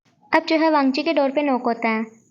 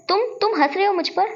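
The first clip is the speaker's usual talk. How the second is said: higher-pitched, stammered question; indignant disbelief; 7 semitones higher than usual. Acted. Wei Ying catches his friend laughing at him on the phone, indignant. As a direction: act mildly affronted, voice lifting.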